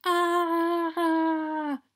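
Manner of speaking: drawn-out, high mock scream. A narrator's reading. Dragons scrambling to get away, high drawn-out mock scream. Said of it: "screamed in unison".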